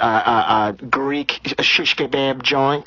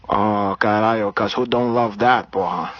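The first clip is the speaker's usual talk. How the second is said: put-on Brazilian accent; 3 semitones higher than usual. Comedian holding a put-on Brazilian accent.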